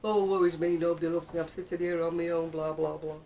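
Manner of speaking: loud mock lament, rattled off on one note; mock self-pity. Telling viewers not to mope alone, mock self-pity. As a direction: wallow theatrically in self-pity. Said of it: "Please don't sit there going".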